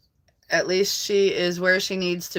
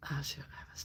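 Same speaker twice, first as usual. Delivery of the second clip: whispered to herself, no voice; 3 semitones lower, 20 dB quieter, much faster than usual. Real, not acted. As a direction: breathe the words, catching her slip. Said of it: "I had to read that twice".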